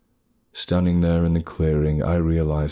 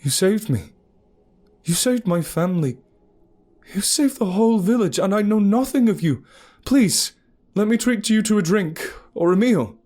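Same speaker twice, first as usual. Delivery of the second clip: high, loud rising plea; pleading gratitude; 3 dB louder than usual. Acted. Narrator begging the huntress to stay, loud pleading. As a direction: plead with her, building in urgency.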